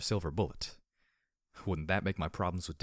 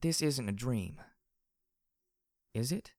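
slow, higher voice, lifting on the question; faint hope; 5 semitones higher, slower than usual. A narrator's reading. Glory asks if she is dreaming, faintly hopeful. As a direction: ask slowly with a tiny catch of hope. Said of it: "tiny catch of hope in her voice".

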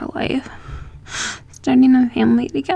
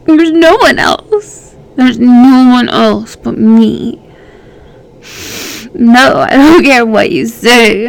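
possessive insistence; 6 dB louder than usual. Acted. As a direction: drive each word home, louder.

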